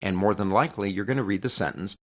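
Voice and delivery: usual speaking voice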